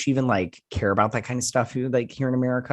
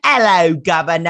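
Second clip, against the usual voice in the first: high, loud caricature Cockney greeting; mock Cockney accent; 5 semitones higher, 8 dB louder than usual. American showing what passes for British, hammed-up Cockney accent. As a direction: belt it out like a cartoon Cockney. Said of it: "Okay, he's British. He's British".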